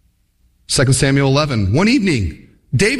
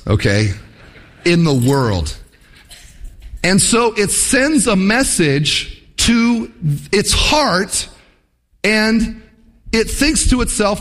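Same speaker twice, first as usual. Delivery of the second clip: ordinary delivery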